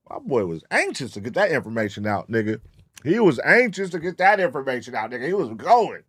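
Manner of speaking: usual speaking voice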